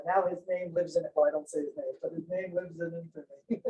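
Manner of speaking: normal talking voice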